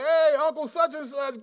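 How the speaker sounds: high, loud, over-the-top hello; mock cheery greeting. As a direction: fake a gushing welcome to an uncle. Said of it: "be like".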